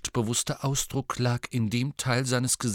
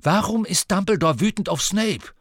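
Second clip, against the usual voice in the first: louder, higher, insistent demand; shouting; 11 semitones higher, 7 dB louder than usual. Acted. Harry loudly presses Hagrid about Snape, shouting. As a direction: call out to force an answer. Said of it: "Schrei sowas nicht rum".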